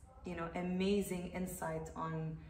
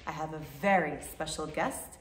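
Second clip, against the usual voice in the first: YouTube host intro; 11 dB louder than usual. Acted. Host opening a YouTube video for viewers, presenter greeting. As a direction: greet the viewers at full volume, pitched up at the start.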